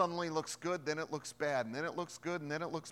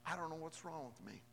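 ordinary talk, nothing marked

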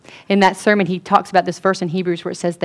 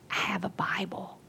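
secret hushed whisper; 17 dB quieter than usual. Retold or imitated. Student confiding to the preacher, whispering. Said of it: "she whispered to me".

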